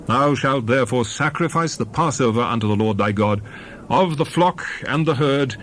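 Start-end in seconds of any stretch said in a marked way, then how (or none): none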